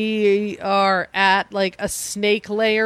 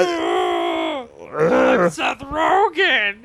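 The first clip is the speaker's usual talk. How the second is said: Yeti voice; much slower than usual. Pretend. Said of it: "how do you do a Yeti".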